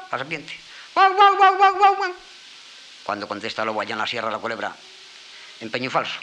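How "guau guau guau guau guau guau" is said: very high, loud barking howls; bay up at the hills to call the wolf; fox howling. Acted. Fox on the rock calling the wolf, loud high howls.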